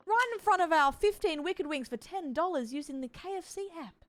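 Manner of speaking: broad Aussie accent, hammed for the ad. Streamer joking about her sponsored wings in a broad Aussie accent. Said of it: "The accent I do with it".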